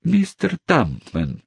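very high, slow coy lady voice; whispered. Acted. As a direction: breathe his name, turning away. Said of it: "прошептала незамужняя тётушка".

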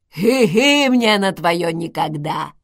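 loud, slow mocking old-woman voice; rude old woman. A narrator's reading. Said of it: "невежливо сказал голос старушки". (невежливо, голосом старушки)

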